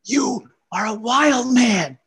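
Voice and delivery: high, slow, adoring exclamation on wild; impressed wife. Retold or imitated. Wife praising husband home from the show, high and slow adoring gush. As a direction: gush at him, stretching wild man. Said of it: "she smacks him on the chest and she goes".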